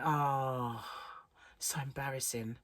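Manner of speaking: hushed, whispered embarrassment; embarrassed aside. Retold or imitated. Telling how friends looked at me, embarrassed whisper. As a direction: sigh it out, then drop into a whisper. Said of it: "I went".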